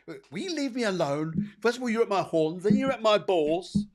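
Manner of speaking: high, loud, exasperated complaining cow; cartoon cow protesting loudly; indignant cow voice. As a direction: play the fed-up cow, squeaky and outraged. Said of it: "The poor cow here is going".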